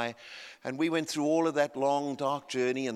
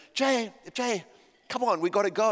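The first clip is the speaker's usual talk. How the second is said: higher-pitched retold nudge; hurrying someone along; 7 semitones higher, 3 dB louder than usual. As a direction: chivvy your son along. Hurrying his son in retold scene.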